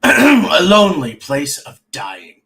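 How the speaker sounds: loud, slow, booming title read; hammed-up announcer delivery; dramatic title announcement. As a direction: announce it grandly, drawing out each word. Announces title theatrically after retake.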